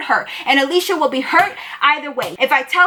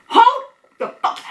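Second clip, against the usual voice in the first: shocked interruption; 13 semitones higher than usual. Retold or imitated. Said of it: "I didn't even let him finish".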